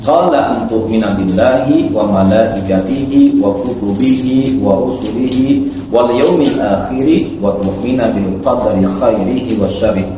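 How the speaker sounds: ordinary talk, nothing marked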